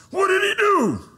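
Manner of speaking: high, loud, alarmed shout; protective outburst. Retold or imitated. Husband shouting at his crying wife. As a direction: demand it like the big protector.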